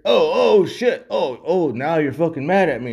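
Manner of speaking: high, loud mock outcry, easing off at the end; mock alarm. Mimicking someone who gets mad at being noticed, mock alarm. Imitating an overreaction to a remark.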